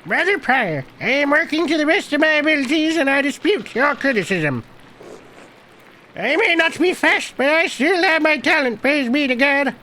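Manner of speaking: put-on high-pitched old monk; old man voice. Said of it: "I was old man voice".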